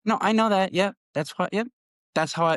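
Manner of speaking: high, mocking kid voice, repeating it over and over; feisty daughter. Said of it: "she was getting kinda feisty with me".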